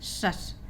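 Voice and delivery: "सस" as one syllable, shus; pronunciation demo. Shown as a demonstration. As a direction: say "सस" alone as a hushed shus. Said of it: "इसको हम पढ़ेंगे सस".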